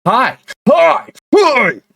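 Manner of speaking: high, loud hammed-up "hi"; exaggerated high-pitched yelped hi; hi contest. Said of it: "hi contest".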